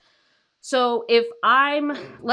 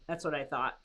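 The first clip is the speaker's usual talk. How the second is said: much softer, lower murmur; quiet aside; 6 semitones lower, 10 dB quieter than usual. Real, not acted. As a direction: drop it under your breath to the pet.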